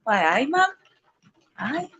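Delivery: light, playful Geordie; Geordie accent. Shown as a demonstration. Streamer showing chat she can do Geordie, Geordie accent. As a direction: put on a Geordie accent, voice rising at the end. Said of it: "I can do Geordie".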